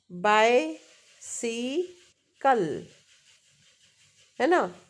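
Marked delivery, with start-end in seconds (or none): 0.1-2.9 bicycle split as बाय, सी, कल; very slow bicycle, a pause between syllables; three-syllable breakdown. Shown as a demonstration. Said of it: "three syllabic words क्या है".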